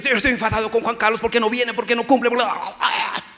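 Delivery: fast sing-song whining; mock complaining. Pastor mocks a petty prayer of complaint to God, sing-song. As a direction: rattle off the grievances in a mocking singsong. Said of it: "no estoy diciendo".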